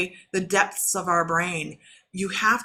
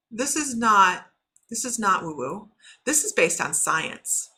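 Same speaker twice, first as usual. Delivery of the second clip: usual speaking voice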